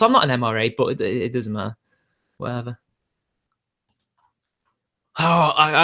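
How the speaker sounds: ordinary talk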